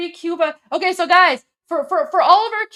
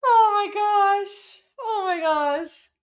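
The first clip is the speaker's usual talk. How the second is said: slow, drawn-out and higher, half amused; stunned; 6 semitones higher, much slower than usual. Real, not acted. Streamer reacting to the Trumpo parody, slow amused disbelief. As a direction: let the disbelief stretch out.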